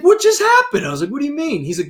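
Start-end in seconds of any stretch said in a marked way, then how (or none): 0.0-0.9 high, loud mock astonishment; stunned disbelief. Acted stunned friend. 1.1-1.6 high-pitched imitated confusion; innocent puzzlement. Acted oblivious reply to friend.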